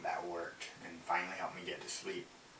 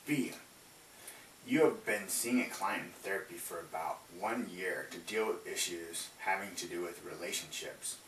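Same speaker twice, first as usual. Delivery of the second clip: louder formal read-aloud voice; 4 dB louder than usual. Acted. Reads case scenario aloud.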